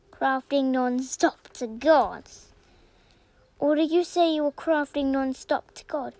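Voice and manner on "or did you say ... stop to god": ordinary talk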